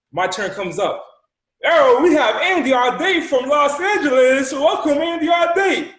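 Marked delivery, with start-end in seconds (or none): 1.7-6.0 high, loud, drawn-out announcer welcome; hyped host announcement. Retold or imitated. Host welcomes Andy on the group call, hyped announcer. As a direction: shout the caller's name like a big show intro.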